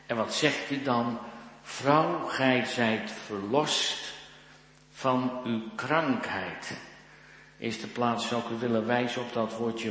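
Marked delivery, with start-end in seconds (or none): 1.6-6.8 raised opening word, then very slow proclamation; solemn calling out. Acted. Jesus calls the bent woman to him, solemn slow proclamation. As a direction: call to her, then spread each word out slowly. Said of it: "en hij roept haar".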